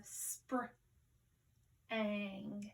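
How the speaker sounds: "spr-" as one blend, pause, then "ang"; sounding out. Shown as a demonstration. Teacher blending sounds for pupils, "spr-" then "ang" in two parts. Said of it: "let's sound this out".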